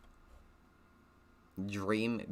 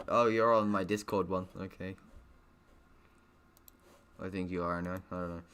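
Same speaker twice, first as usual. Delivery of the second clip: plain reading voice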